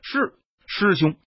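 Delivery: lightly voiced subordinate's reply; obedient underling. A narrator's reading. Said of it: "他下令道".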